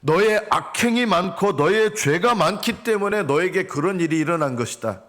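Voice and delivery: acted stern divine verdict; God's rebuke. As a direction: rebuke the people as God. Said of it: "왜 그렇게 부르짖느냐?".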